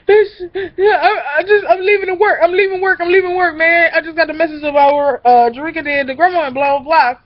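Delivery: high, teary and breathless; panicked tearful rush; imitating a sobbing cousin leaving work; crying cousin. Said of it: "She crying and shit".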